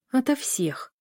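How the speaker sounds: повыше, с лёгким недовольством; буркнуть в ответ; ворчливо. A narrator's reading. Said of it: "проворчала Лиля". (недовольное ворчание)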